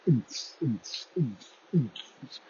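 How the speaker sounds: soft mouthed thumping bass beat; imitated house beat. Mimicking house music in the background, beatbox thump. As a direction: pulse it like club music. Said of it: "sexy house music bumping in the background".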